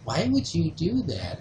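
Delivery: a hint of a disappointed grandmother; imitated scolding grandmother. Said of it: "and then she says this".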